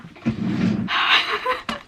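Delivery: breathy rising sigh, fighting tears; shaky high sigh. Real, not acted. Vlogger calming herself before going on, tearful sigh. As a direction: let out a trembling sigh. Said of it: "try not to get emotional".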